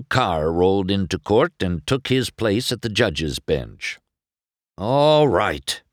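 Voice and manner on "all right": raised, higher opening of a rant. A narrator's reading. Judge taking the bench over his wrecked town, ranting. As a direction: launch into an angry tirade. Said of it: "Doc ranted".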